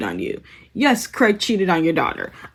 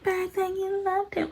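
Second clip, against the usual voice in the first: much higher and much softer airhead voice; airheaded aunt; 12 semitones higher, 11 dB quieter than usual. Retold or imitated. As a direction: breathe it out like a clueless aunt. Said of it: "She's like".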